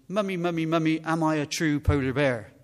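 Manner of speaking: loud, eager calling for mummy; young polar bear. Acted. Polar bear cub asking his mummy a question, insistent calling. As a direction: play a little bear pestering its parent. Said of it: "he says".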